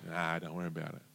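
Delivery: soft, low, casual dismissal; unbothered calm. Acted. Calm friend to panicking passenger, soft and low. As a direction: brush it off quietly.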